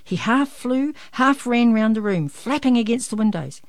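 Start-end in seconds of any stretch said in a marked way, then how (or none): none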